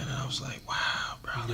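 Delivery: whispered awe; hushed amazement. Retold or imitated. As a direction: drop to a soft whisper, as if stunned. Retells his amazed reaction.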